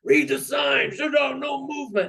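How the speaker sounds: high, strained mock scream; imitated screaming guard. Drunk tank guard screaming orders at a detainee, imitated high scream. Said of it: "starts screaming at me".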